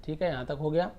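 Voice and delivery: ordinary delivery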